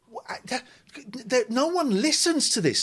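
high-pitched, stammering incredulous thought; acted disbelief. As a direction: act out stunned disbelief, stumbling at the start. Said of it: "And I'm in bed thinking".

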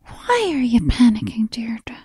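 put-on hushed, calm old nun from school. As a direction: soothe her like a school nun in a whisper. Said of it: "that very calm, like, old nun at school voice".

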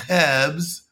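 mock French accent on "herbs"; imitated French-accented "herbs". Shown as a demonstration. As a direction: say "herbs" as a French learner of British English. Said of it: "So a French person would say".

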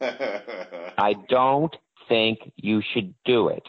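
slow, drawn-out agent voice; an imitated agent with a lightly changed voice; imitating Stark Hasseltine. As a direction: stretch every word like the old agent. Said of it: "This is how he talked".

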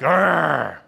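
mock dog growl in tug of war; playful growl. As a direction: growl like playing with a puppy. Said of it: "and you're like".

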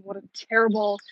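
ordinary talk

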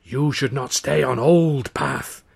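slow rasping fortune teller; old woman's rasping voice. Retold or imitated. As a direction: rasp it out like an old seer. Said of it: "in the old woman's rasping voice".

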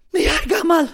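loud, high-pitched complaint; agitated neighbour. Retold or imitated. Neighbour fending off complaint at the door, agitated. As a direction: cry it out as the worked-up neighbour.

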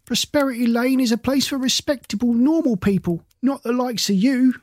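high-pitched heckling yell from the back; a mild yell from a hostile neighbour. A narrator's reading. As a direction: throw it at her from the back of the crowd. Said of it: "someone in the back yelled".

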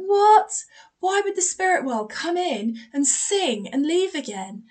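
high, loud bewildered questioning; retold incredulity. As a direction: re-enact being baffled and amazed. Her incredulous reply re-enacted.

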